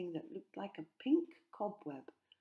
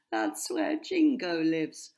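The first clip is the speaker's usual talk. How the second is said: laughing; 10 dB louder than usual. A narrator's reading. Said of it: "Hex laughed".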